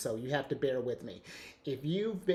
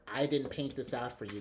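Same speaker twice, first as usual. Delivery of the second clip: normal talking voice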